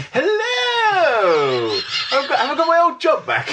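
very high, stretched-out delighted hello; hammed-up joy at an unexpected call; elated. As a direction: re-enact being overjoyed on the phone. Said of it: "I was so elated to hear from him".

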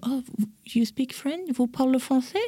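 high, soft, amazed American voice; hammed-up American gushing, high and soft; imitating American tutors. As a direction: mimic an awestruck American tutor.

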